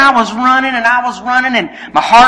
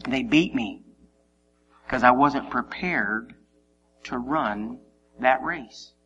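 mock-solemn defeat; 11 semitones lower, 10 dB quieter than usual. Preacher confessing his wife passed him, low dejected delivery. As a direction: drop down low and admit it gravely.

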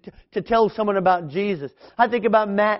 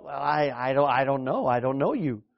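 hesitant mumble; 7 semitones lower, 7 dB quieter than usual. Retold or imitated. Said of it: "they go".

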